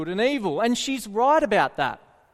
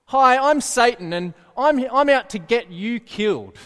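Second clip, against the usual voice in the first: loud, brazen announcement as a joke Satan; mock Satan introduction; 3 semitones higher, 5 dB louder than usual. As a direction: announce yourself loudly as a hammed-up devil.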